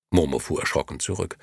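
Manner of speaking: plain reading voice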